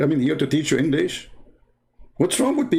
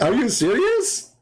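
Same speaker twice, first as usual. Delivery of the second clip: higher, slow disbelief; incredulous; 9 semitones higher than usual. Retold or imitated. Narrator reacting in disbelief, higher and slow. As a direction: stretch out the disbelief. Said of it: "I'm like".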